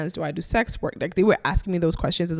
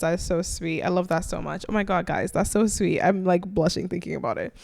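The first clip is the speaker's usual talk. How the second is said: ordinary delivery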